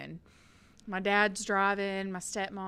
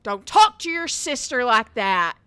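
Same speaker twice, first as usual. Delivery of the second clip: loud scolding father voice; stern dad shout; 4 semitones higher, 14 dB louder than usual. Retold or imitated. Dad scolding son from the driver's seat, loud stern call. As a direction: bark the warning at the son. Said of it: "my dad said".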